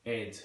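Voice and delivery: "Ed" for head, no H; dropped H. Shown as a demonstration. Teacher demonstrating East London, "Ed" with dropped H. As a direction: say "Ed" as Cockney for head. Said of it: "not pronouncing the H".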